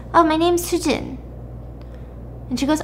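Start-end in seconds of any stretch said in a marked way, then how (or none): 0.1-1.2 lightly voiced child, pitched higher; a hint of a small girl's voice, higher; little girl. Little girl tells the woman her name, lightly childlike.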